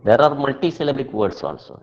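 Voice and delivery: ordinary delivery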